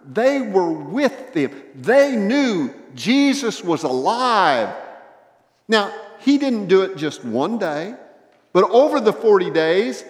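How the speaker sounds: loud, high preaching with emphatic rises; full-force preaching. Real, not acted. Preacher telling church Jesus was alive, full-force preaching. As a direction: proclaim it to the whole room.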